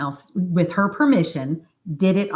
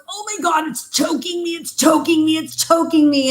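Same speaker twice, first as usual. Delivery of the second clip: high-pitched mock panic, growing louder; imitating panicked client; 13 semitones higher, 3 dB louder than usual. Client on the massage table cries out choking, acted panic. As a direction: act out a frantic cry for help.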